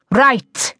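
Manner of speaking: angry teacher's mild shout; restrained narrated shout. A narrator's reading. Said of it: "he shouted".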